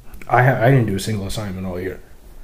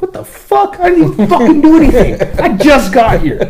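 much higher and louder, laughing outrage; mock-outraged yell, pitched way up; outraged disbelief; 6 dB louder than usual. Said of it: "I'm like".

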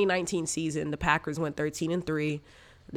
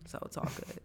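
soft whispered aside; 13 dB quieter than usual. Acted. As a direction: breathe it out under your breath. Quiet aside after congestion joke.

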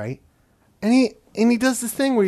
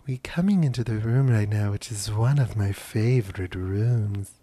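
Jackie impression; 7 semitones lower than usual. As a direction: put on the first lady's voice, slowing at the end. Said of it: "I tried doing Jackie".

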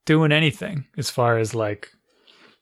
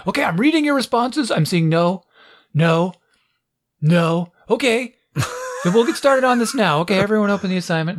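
higher-pitched classroom teacher voice; brisk teacher impression; 5 semitones higher than usual. Teacher reading chat answers to the class, brisk. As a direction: imitate a teacher reading out votes, then pressing on. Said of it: "this is how she sounds".

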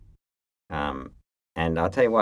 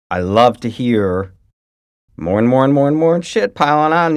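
gleeful, higher and louder; 4 semitones higher, 4 dB louder than usual. Real, not acted. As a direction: relish every word of the gossip. Gleeful gossip.

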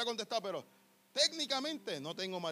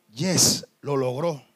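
loud, lower victory shout; triumphant cheer; 7 semitones lower, 13 dB louder, much slower than usual. Real, not acted. Preacher celebrating that the woman finally asks, loud cheer. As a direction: shout it like the winning goal.

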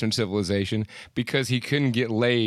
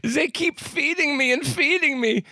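high-pitched mock German accent; 11 semitones higher than usual. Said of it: "saying this in a German accent".

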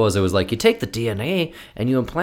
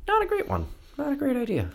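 mock voice; 7 dB quieter than usual. Dismissive put-on voice.

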